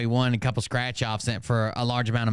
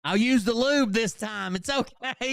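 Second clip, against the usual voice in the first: mock coaxing; 9 semitones higher than usual. Heckler pretending to lure Shadow back, mock sweet falsetto. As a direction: coax in a hammed-up high voice.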